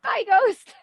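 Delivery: a high, breezy farewell; mock breezy goodbye. Mimicking a partner ghosting, breezy and high. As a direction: toss off the goodbye lightly and high. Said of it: "and then".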